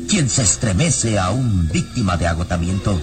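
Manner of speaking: ordinary delivery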